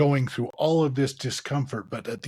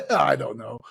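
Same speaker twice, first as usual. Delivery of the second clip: laughing through the words, louder; 2 semitones higher, 8 dB louder than usual. Real, not acted. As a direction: chuckle out a sheepish admission. Laughs at own snark.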